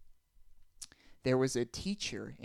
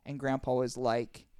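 normal talking voice

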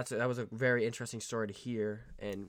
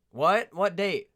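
loud; 7 semitones higher, 12 dB louder, much slower than usual. Real, not acted. Shouting at the other speaker.

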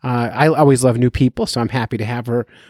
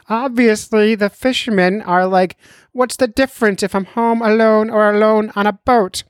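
high, lecturing doctor voice; 8 semitones higher than usual. Retold or imitated. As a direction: raise the pitch, lecture like a doctor. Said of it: "I'll do both voices".

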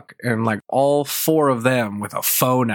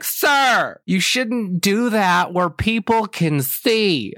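higher, slow, prim scolding voice; imitating a scolding lady; 7 semitones higher, much slower than usual. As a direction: lecture him like a disapproving stranger. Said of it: "She's like".

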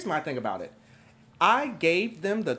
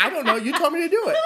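higher, breaking into laughter on the last words; laughing through it; 9 semitones higher, 5 dB louder, much faster than usual. Retold or imitated. Babysitter retelling a girl's excuse, laughing. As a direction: repeat the girl's excuse while cracking up. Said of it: "And she'd be like".

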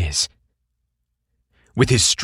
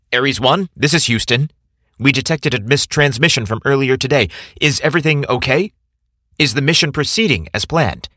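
higher radio dispatcher voice; mission control voice; 6 semitones higher than usual. Acted. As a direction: call in like Houston control. Mission control calls over intercom.

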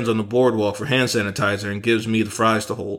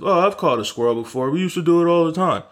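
mock know-it-all; 6 semitones higher, faster than usual. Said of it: "I know to some people".

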